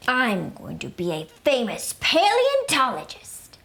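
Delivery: usual speaking voice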